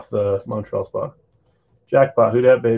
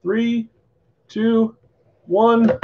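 excited countdown; 10 semitones higher, much slower than usual. Real, not acted. Countdown to pack reveal.

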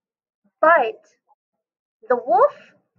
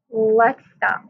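normal talking voice

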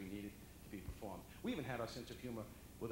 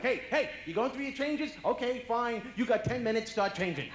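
1 impatient father; 12 semitones higher, 14 dB louder than usual. Retold or imitated. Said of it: "My father would be like".

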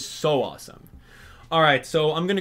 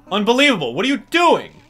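cranky mole calling out, louder and higher; grumpy mole barking at the player; Mister Resetti voice; 7 semitones higher, 7 dB louder than usual. Acted. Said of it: "I do a very good Mister Resetti".

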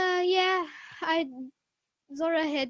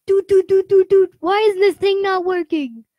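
loud, higher exasperated complaint; retold frustration; 5 semitones higher, 9 dB louder than usual. Player recounting the computer that wouldn't start, exasperated. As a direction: act out your frustration at a broken gadget.

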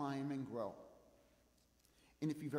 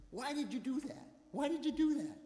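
high-pitched mock scolding, louder on the repeat; imitated scolding; 10 semitones higher, 5 dB louder than usual. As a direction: imitate a wife telling off her husband. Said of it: "she starts scolding me".

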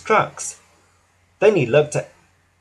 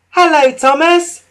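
put-on much higher, loud children's call; 13 semitones higher, 8 dB louder than usual. A narrator's reading. Children greeting Thomas from bridges, high and loud. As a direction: wave and shout from the bridge. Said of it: "they called".

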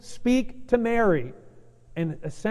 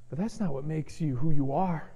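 usual speaking voice

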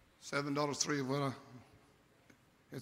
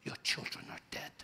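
whispered, softer, barely there; dying whisper; 8 dB quieter than usual. Acted. Dying servant to Job, a hushed whisper. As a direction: breathe it out like a last breath.

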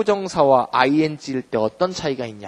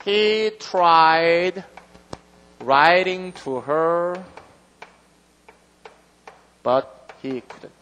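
very slow, careful reading; slow example reading; much slower than usual. Shown as a demonstration. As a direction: lay out each word slowly. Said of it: "여기 볼게요". (아주 천천히 예문 읽기)

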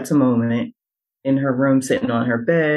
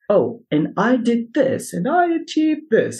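high, self-important bragging; pompous voice; 8 semitones higher than usual. Retold or imitated. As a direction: puff up like a show-off. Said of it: "Is that your LinkedIn voice?".

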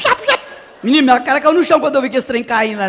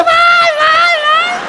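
desperate urging; 4 dB louder, much slower than usual. Retold or imitated. Urging during painful waxing.

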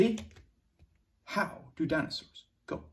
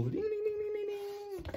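high, soft ding ding ding; bell sounds; 7 dB quieter, much faster than usual. Shown as a demonstration. Teacher rings the bell for the story. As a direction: chime it like a school bell.

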